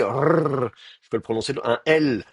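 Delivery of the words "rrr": "Rrr" held out as one long trilled r; long rolled r. Shown as a demonstration. Teacher showing students an r held long. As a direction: stretch the "Rrr" out, rolling it. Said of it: "je peux l'allonger".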